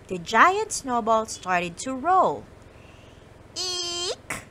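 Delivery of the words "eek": high, soft frightened mouse squeak. Acted. The mice squeak in fright as the snowball rolls, high and soft. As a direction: let out a tiny scared squeak. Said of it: "squeaked the mice".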